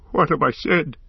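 high, remorseful outcry; distraught cry. A narrator's reading. Boromir realises what he has said, high distraught cry. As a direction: cry out in horror at yourself. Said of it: "he cried".

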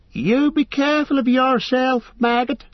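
high-pitched wife calling out from the doorway; farmer's wife calling. A narrator's reading. Farm wife calling after her husband at the wagon, high and fond. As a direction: call after him, fussing like a farm wife. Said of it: "she called".

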